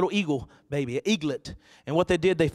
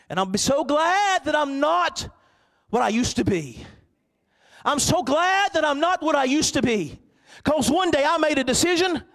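fervent preaching testimony; 10 semitones higher, 5 dB louder than usual. Acted. Pastor testifying about his change, in fervent preaching. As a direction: testify with joyful conviction.

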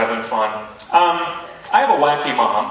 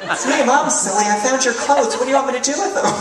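higher, chirpy mom on the phone; a hint of a silly mom; 11 semitones higher than usual. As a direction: play your mom calling at 3 a.m. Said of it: "She called me up".

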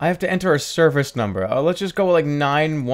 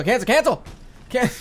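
frantic cancel; 8 semitones higher than usual. Real, not acted. Gamer stabbing the cancel button, panicked yelp.

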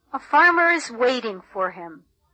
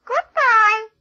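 much higher, louder spider goodbye; spider's voice; 11 semitones higher, 4 dB louder than usual. Acted. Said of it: "says the spider to the fly".

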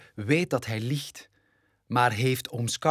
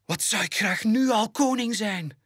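cub exclaiming; 9 semitones higher than usual. Acted. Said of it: "roept Simba uit".

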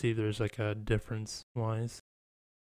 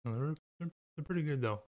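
eating a snack while speaking; talking while chewing; 5 semitones higher than usual. Real, not acted. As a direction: mouth partly full of cake. Seen 0: Cake held at lips; he eats across the clip, the mouth looks busy.

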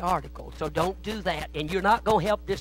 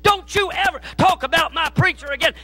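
roaring, much higher and louder, furious; furious yelling; 11 semitones higher, 10 dB louder than usual. Retold or imitated. Convert attacking a critic of his pastor, furious yelling. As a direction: bellow it like you're pounding his head into the floor.

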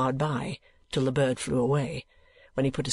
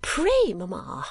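daughter's higher-pitched entreaty; urgent plea; 5 semitones higher, much slower than usual. Acted. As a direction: plead sharply on the first word, dropping on the second. Daughter repeats her plea more urgently.

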